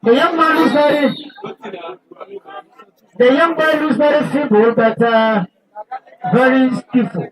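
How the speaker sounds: radio sports presenter. Real, not acted. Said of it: "that radio voice".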